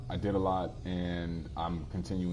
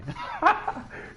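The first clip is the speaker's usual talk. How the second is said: high burst of laughter; 5 dB louder than usual. Real, not acted. Laughing at chat's joke.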